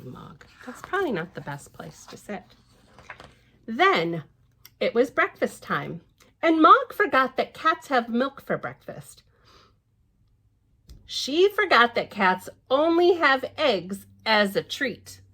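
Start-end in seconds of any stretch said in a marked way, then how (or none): 3.6-15.2 lively storybook reading voice; picture-book narration; reading to children. Acted. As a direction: read it out brightly to little ones. Reads the book aloud.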